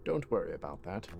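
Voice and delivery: put-on a hushed, secretive British accent. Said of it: "kinda like a British accent".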